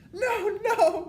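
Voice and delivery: very high, loud wail; terrified witch shriek; begging. A narrator's reading. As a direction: wail for mercy. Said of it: "begged the witch".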